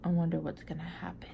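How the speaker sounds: fast hushed whisper; whispered aside. Real, not acted. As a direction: lean in and whisper the suspense. Aside to viewers, suspense.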